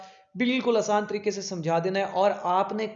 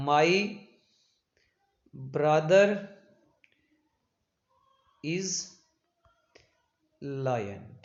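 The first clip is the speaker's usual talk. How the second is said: each word set apart by long pauses; slow example sentence; 3 semitones lower, much slower than usual. Shown as a demonstration. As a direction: lay out each word slowly, like dictating. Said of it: "जैसे मैं कहता हूँ". (हर शब्द के बीच लंबा ठहराव, धीरे-धीरे बोलें)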